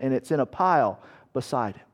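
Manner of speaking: normal talking voice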